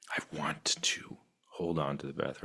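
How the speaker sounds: breathy whispered aside. Pretend. Teacher whispering her inner wish to students. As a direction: whisper it as if to yourself.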